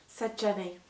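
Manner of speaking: ordinary delivery, nothing marked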